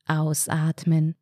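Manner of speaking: slow, monotone relaxation instruction; breathing-exercise cue. Acted. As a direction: stretch it out evenly, like a relaxation coach. Entspannte Atemübung im Kopf angesagt. (langsam und monoton, wie bei einer Atemübung)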